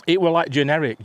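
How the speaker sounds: ordinary delivery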